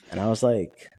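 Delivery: ordinary talk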